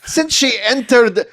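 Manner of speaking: lightly put-on Scottish accent, rising high by the end. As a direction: read the statement in a mock Scottish brogue. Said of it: "do my Scottish one".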